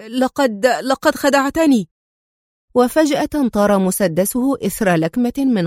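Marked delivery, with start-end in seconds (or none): 0.1-1.9 higher, faltering, frightened; a hint of terror behind the gun; panicked stammer. A narrator's reading. Tino aiming at Adham, mildly panicked stammer. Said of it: "وهو يقول في ذعر".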